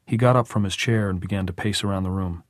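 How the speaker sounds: usual speaking voice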